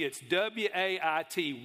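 W A I T slowly spelled letter by letter; spelling it out. Shown as a demonstration. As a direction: spell out W A I T carefully. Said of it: "It's not W E I G H T either".

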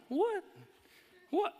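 high surprised what; puzzled bystanders; confused what. Retold or imitated. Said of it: "we're looking around going".

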